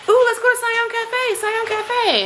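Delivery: put-on high, loud, eager kid voice; chirpy little kid pleading; imitating excited child. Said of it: "Sage is like".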